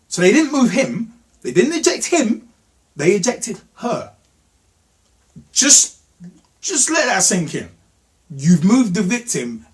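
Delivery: high, incredulous outrage; indignant emphasis. Real, not acted. Telling viewers they ejected the victim, indignant. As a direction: pitch jumps up on each pointed phrase.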